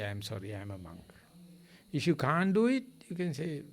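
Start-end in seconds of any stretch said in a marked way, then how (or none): 0.0-1.1 soft, lower 'I am sorry, I am a monk'; gentle polite refusal. Shown as a demonstration. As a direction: decline humbly, much softer and lower. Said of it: "just say".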